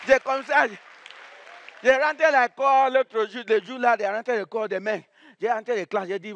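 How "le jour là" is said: ordinary talk, nothing marked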